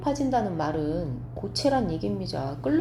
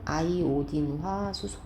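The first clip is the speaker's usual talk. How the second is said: slow, lower 아이오딘화 수소; reading demonstration; 4 semitones lower, 4 dB quieter, slower than usual. Shown as a demonstration. Teacher modelling the last compound name, slow demonstration. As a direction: draw out 아이오딘화 수소 at a low pitch. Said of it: "이렇게 읽어야죠".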